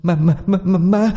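high, frightened stammer on each ma; stammering in fright. A narrator's reading. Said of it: "Miệng lắp bắp". (lắp bắp sợ hãi, giọng cao)

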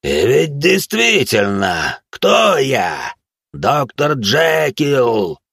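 slow, swelling monstrous shout; roaring monster. Acted. Man swelling into monster before youths, roaring. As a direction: draw the words out into a roar.

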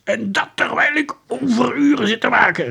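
high, loud outraged cry; seething detective's outburst; furious. A narrator's reading. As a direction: fume and sputter loudly at high pitch. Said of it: "zag rood van woede".

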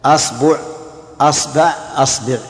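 أصبع with fatha on the hamza, then the ba in three vowels; asbu with a. Shown as a demonstration. Teacher drilling students in the fatha forms of أصبع, pronunciation demo. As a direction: say أصبع as asbu, asba, asbi. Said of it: "خذوا فتح الهمزة وتثليث الباء".

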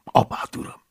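usual speaking voice

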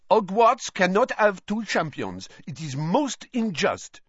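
high indignant French lady; a haughty French headmistress objecting; high and loud with a French lilt; Madame Maxime protesting. A narrator's reading.

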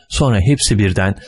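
usual speaking voice

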